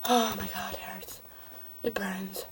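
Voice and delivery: whispering while it burns; hushed, hurting whisper; pained whisper. Real, not acted. Whispering in pain.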